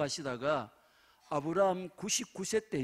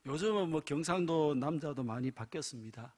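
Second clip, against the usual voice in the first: usual speaking voice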